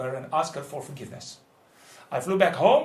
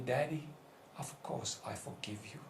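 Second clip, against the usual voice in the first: hushed forgiveness; 6 semitones lower, 18 dB quieter than usual. Retold or imitated. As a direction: voice drops to a near whisper. Tearful daughter's forgiveness retold.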